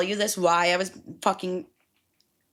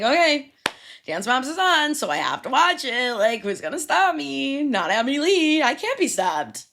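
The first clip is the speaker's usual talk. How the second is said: mock defiant; 6 semitones higher, 3 dB louder than usual. Mock defiance about watching TV.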